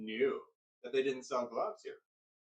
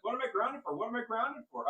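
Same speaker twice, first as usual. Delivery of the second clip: high, loud, insistent asking; indignant demand; 11 semitones higher, 4 dB louder than usual. Retold or imitated. Child pressing mother for the reason, high and loud. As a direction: keep demanding an answer.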